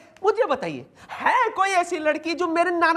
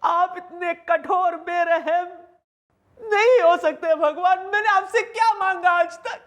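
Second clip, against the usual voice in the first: hammed-up crying, pleading with heaven; tearful wailing; 3 semitones higher than usual. Man wailing to God about losing grandfather, melodramatically tearful. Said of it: "why are you crying".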